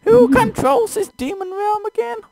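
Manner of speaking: high, loud, strained falsetto purr; purring demon. Acted. Demon queen purring at the adventurer, in a high loud falsetto. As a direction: ham up a seductive demon purr. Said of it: "The female demon purrs".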